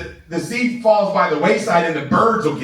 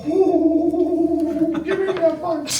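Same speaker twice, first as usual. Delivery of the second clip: high, gleeful squeal of delight; giddy high-pitched delight; excited squeal; 11 semitones higher, much slower than usual. Real, not acted. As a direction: squeal it out with glee. Excited squeal about having fun.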